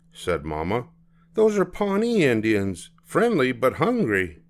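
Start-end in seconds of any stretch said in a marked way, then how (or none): 1.3-4.4 mother voice. Acted. Mama voiced.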